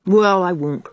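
plain reading voice

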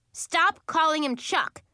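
high, loud angry protest; yelling. A narrator's reading. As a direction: yell to defend someone. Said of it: "I yelled".

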